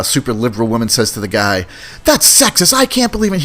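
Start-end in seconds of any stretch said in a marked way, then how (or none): 2.0-3.4 high-pitched mock outrage; indignant retort. Super liberal woman arguing with a guy at dinner, mock indignant. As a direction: act out an offended objection. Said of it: "getting into a little bit of a fight".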